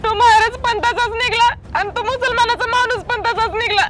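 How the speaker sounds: high, tearful wail about the men; woman weeping to her mother about the fake holy men; crying complaint. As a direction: sob it out in a high voice.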